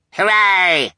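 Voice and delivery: high, loud cheering hooray; crowd cheer. A narrator's reading. Crowd cheers for the lion show, shouting. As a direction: shout it with bloodthirsty glee.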